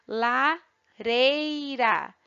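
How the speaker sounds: "Lareira" very slow as la, rei, ra; split into syllables. Shown as a demonstration. Teacher showing pupils three sounds in a word, syllable by syllable. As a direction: stretch Lareira out, pausing after the first syllable. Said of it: "Também são três sons".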